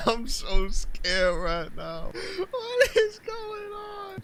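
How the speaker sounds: high, slow, wailing panic; mock scared. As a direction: squeal it out in hammed-up terror.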